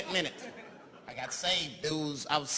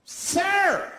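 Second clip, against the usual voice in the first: high, loud shout of "sir"; scandalized passenger calling out; indignant shout; 13 semitones higher, 7 dB louder than usual. Acted. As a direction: call out sharply to stop a stranger. Offended passenger calls out to smoker.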